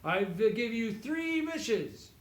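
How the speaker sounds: slow, booming genie. Retold or imitated. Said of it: "and the genie said".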